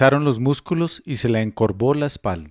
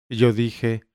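ordinary delivery

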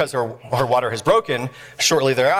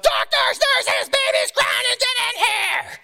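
high, drawn-out frantic holler; yelling out; slower than usual. Retold or imitated. Nurse calling doctors to the delivery, frantic yell. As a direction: scream for help down the hall. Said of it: "the nurse yells out".

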